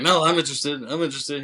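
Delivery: eager protest. Retold or imitated. Homeless man grabs for the money.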